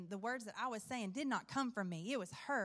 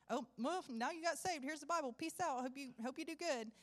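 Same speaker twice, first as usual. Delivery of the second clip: higher, singsong careless send-off; mock breezy brush-off; 4 semitones higher than usual. Evangelist parodying abandoning a new convert, mock breezy brush-off. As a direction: toss it off as a pretend cheery goodbye.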